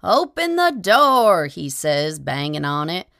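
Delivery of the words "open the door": high, loud and slow begging; frantic plea. A narrator's reading. Tramp pleading at the bolted door, loud high frantic cry. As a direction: beg desperately to be let in. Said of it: "sobbing his hardest to be let in".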